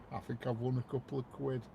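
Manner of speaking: lightly put-on Cockney accent; attempted Cockney accent. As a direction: try a Cockney accent and admit it's weak. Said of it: "I can't do a Cockney accent".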